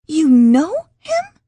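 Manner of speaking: the disbelief breaks into a shriek; squealed. Acted. J C learning Max knows Jerry, slow shrieking squeal. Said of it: "she squealed".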